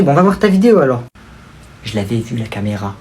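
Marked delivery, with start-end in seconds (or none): 1.8-2.9 much lower, much softer aside; sly aside. Acted. Cousin confiding he spotted the camera, soft and low. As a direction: murmur it under your breath.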